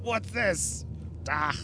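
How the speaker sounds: put-on failed German old-man accent; a bad German accent that drifts Russian. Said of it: "I can't do a German accent".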